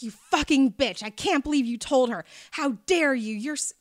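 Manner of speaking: retold furious phone rant; angry outburst. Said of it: "And he's like".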